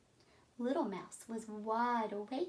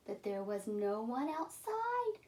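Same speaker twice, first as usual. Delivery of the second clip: ordinary delivery, nothing marked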